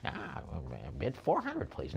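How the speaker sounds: drawn-out creepy character bid, swooping in pitch; Joker. Pretend. Auctioneer teasing bidders with a comic-book villain, drawn-out voice. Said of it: "I'll even do the Joker face".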